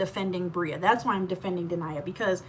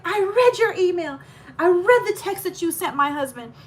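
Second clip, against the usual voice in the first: accusing sister-in-law; 8 semitones higher, 6 dB louder than usual. Retold or imitated. Sister-in-law's accusation voiced.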